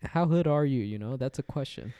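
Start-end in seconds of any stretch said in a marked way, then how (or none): none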